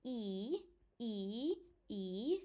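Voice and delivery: "I" as a long "ee" sound; Romanian I. Shown as a demonstration. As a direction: hold "I" as ee, not English "eye". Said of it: "think about my name".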